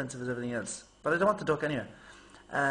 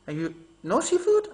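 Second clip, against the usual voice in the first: confused waiter; 5 semitones higher, 8 dB louder than usual. Retold or imitated. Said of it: "he's looking at me going".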